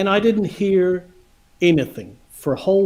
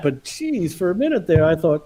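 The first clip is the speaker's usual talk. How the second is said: ordinary talk, nothing marked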